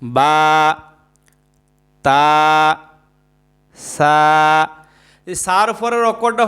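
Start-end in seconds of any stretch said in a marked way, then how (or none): 0.0-4.7 each letter said lower and held with pauses; model letters. Shown as a demonstration. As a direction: recite the letters one by one for repetition. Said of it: "বলেন, আলিফ". (এক এক করে হরফ উচ্চারণ)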